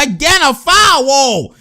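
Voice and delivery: high, drawn-out outcry; protesting shout. Real, not acted. Fan protests another foul, high drawn-out shout. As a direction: cry foul at the screen, high and slow.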